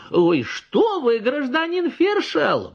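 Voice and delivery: very high, slow protest; protesting peasant woman. A narrator's reading. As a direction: wave it off, offended.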